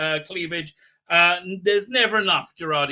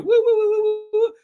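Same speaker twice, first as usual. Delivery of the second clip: very high, loud warbling woo; imitated gadget whir; 7 dB louder than usual. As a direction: mimic a sci-fi device humming.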